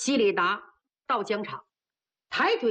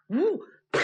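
whoosh and smack; 5 semitones higher than usual. A narrator's reading. 锤打太阳穴.

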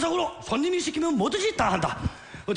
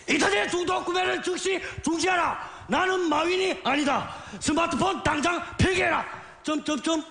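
over enunciating lecture; 5 semitones higher than usual. Acted. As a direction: scold like a stern lecturer. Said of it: "훈계하듯이 또박또박 말해야 한다".

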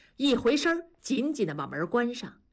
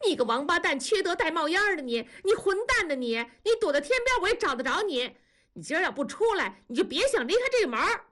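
put-on high-pitched girl wailing curses; shrieky, hurried tirade from a wronged girl; tearful fury; 9 semitones higher, faster than usual. A narrator's reading. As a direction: curse him through the door in an acted rage. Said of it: "女孩子的哭声传进来".

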